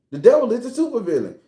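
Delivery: usual speaking voice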